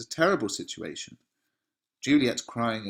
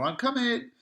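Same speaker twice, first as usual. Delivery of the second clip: high-pitched call toward the door; mock shout. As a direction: call out as if to someone outside, without full volume. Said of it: "He's shouting at the door".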